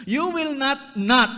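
high, loud, hammering both nots; emphatic double no. Acted. Peter refusing Jesus, loud hammered double negative. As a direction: slam the second not down hard. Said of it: "One after the other".

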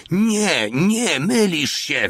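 very high, drawn-out protest cry; sergeant's yelling. A narrator's reading. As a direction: wail the denial, stretching it. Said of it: "wydzierał się sierżant". (przeciągły, wysoki wrzask protestu)

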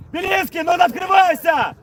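loud high shout across the pitch; yelling from the sidelines. Real, not acted. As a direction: shout the order from the bench. Coach shouts to player. (тренер кричит игроку с бровки)

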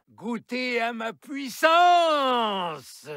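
loud, high, slow roar; evil shout. Acted. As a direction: scream it as you unleash power. Villain unleashes power.

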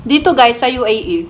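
speaking with food in mouth; words between bites; chewing while talking. Real, not acted. Seen 1: Put food in mouth at -4; lips closed, chewing between phrases.

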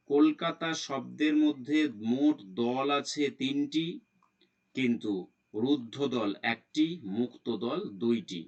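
normal talking voice

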